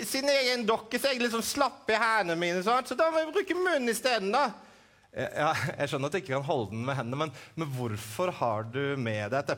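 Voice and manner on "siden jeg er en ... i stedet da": high puppet voice, matter-of-fact; puppet Edvin. Acted. Puppet Edvin telling the host why he holds the apple, high voice. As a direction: explain it plainly as the puppet.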